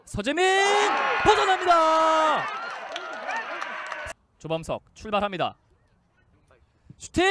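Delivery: high, loud rapid shootout calls; frantic mock sports commentary. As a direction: call each penalty like live TV. Penalty shootout live calls.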